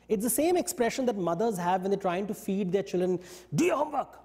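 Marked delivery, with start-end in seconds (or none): 3.5-4.2 high, mock-angry parental order; play-acted anger. Mother play-acting with her child, mock-angry. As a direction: pretend to be cross, pitched up. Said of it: "And you're angry. But that's not real anger".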